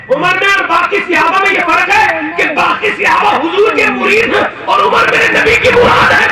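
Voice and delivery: high, loud impassioned sermon shout; preaching at full force. Acted. Preacher roaring to the congregation about Umar. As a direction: cry it out to the crowd.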